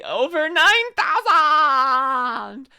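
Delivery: stretched-out high dramatic shout; anime meme shout. Acted. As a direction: bellow it like a cartoon villain.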